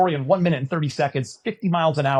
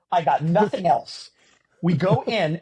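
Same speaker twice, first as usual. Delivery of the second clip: usual speaking voice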